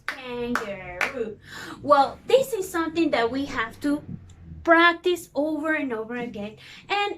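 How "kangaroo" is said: slow syllables. Shown as a demonstration. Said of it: "three syllables".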